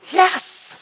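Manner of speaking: high, delighted cry of yes; God beaming over the returning child; joyful God. Retold or imitated. God welcoming a repentant believer, delighted high voice. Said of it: "He's like".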